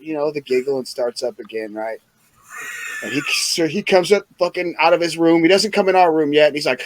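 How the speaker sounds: normal talking voice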